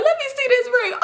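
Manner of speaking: very high, cracking up while talking; hammed-up helpless laughing voice; imitating hard laughter. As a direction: squeal it as if dying laughing. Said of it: "They just dying laughing".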